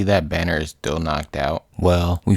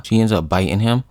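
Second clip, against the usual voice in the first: ordinary delivery, nothing marked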